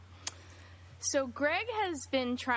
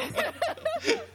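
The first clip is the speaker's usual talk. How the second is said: high cackling laugh; 4 dB louder than usual. Real, not acted. Laughing at own feat.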